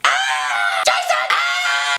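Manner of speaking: loud panicked scream for help; all-out screaming. Retold or imitated. Stuck kid screaming to his friend, all-out scream. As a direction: scream wildly, acting out terror. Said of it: "I start all out screaming".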